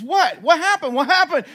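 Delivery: high, loud, urgent questions; alarmed shout. Retold or imitated. Husband hears his wife nearly drowned, loud alarmed questions. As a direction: demand to know, high and shocked.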